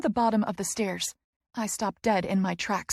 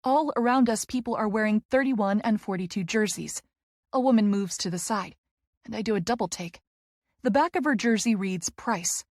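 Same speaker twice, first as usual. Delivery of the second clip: usual speaking voice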